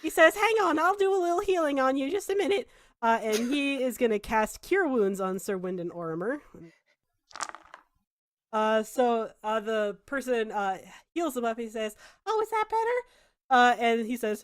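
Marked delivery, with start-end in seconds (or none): 0.4-2.7 higher, fussy helpful voice; kindly healer. Acted. The villager offers healing to the knight, high and kindly. 12.2-13.1 much higher, solicitous question; doting healer. Acted. The healer checks on the knight, high and doting.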